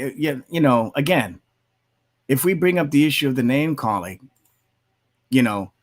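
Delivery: ordinary delivery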